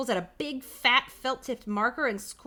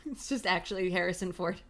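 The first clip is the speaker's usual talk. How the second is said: plain reading voice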